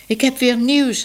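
called out high and loud, eager; cat-girl eagerly announcing new gossip, calling out high; excited call. A narrator's reading. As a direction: burst in eager with the news. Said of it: "riep ze".

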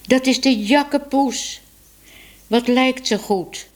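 high-pitched cry of recognition; delighted exclamation. A narrator's reading. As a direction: exclaim it admiringly, pitched well up. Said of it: "riepen Tibbe en Minoes tegelijk".

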